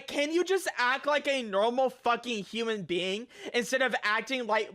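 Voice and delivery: ordinary talk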